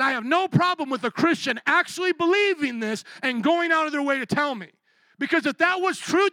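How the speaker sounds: high, loud pleading preacher cry; fiery preaching. Real, not acted. Preacher pleading with the church about the Pope. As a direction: press the point hard from the pulpit.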